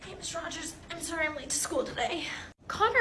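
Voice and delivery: normal talking voice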